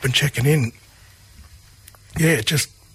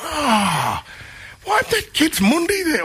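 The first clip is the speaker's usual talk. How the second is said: imitating an elder; 12 semitones higher than usual. Elders calling for kids, quoted.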